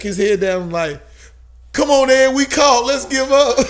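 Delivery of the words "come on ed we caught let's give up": loud, high-pitched call to surrender; raised, high mock pleading shout; imitated friend calling. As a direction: holler at a hidden friend to come out. Imitating friend giving him away.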